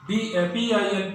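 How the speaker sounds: ordinary talk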